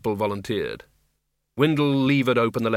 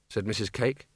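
plain reading voice

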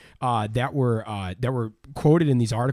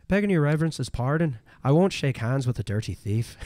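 Irish brogue. Retold or imitated. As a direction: quote the captain in a thick Irish brogue. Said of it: "in a brogue accent".

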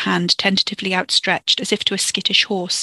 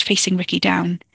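ordinary delivery

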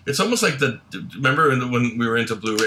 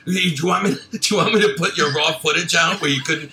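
mock scolding; 6 semitones higher, 3 dB louder than usual. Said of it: "I'm like".

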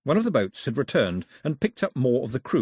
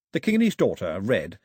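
usual speaking voice

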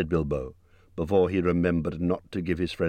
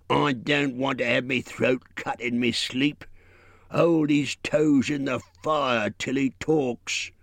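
troll voice; 7 semitones higher, slower than usual. A narrator's reading. Bert insists.

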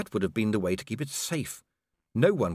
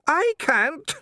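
sobbing; 5 dB louder than usual. Acted. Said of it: "Now Dee was sobbing".

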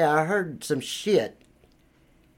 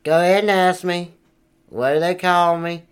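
ordinary delivery

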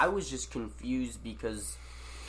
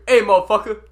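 loud, high holler; imitated shout; 9 semitones higher, 13 dB louder than usual. Stranger hollering at him by the gas pump, imitated shout. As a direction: holler it like someone across a lot.

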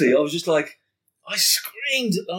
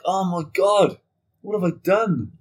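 dismayed panic; 7 semitones higher, 4 dB louder, slower than usual. Retold or imitated.

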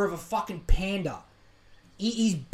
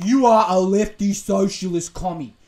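mock angry commenter; 9 dB louder than usual. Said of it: "occasional D M of people being like".

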